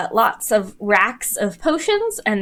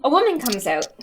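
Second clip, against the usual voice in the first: ordinary delivery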